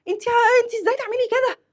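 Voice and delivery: very high, quick acted telling-off; indignant scolding. Passenger scolding friend for driving the wrong way, indignant. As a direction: demand an answer, pitched right up.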